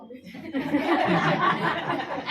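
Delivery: loud laugh from deep down; hearty laugh. Real, not acted. Laughing at husband's wandering.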